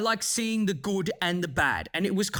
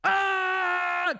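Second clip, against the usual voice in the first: frustrated cry; 14 semitones higher than usual. Real, not acted. Groping for a word, cries out.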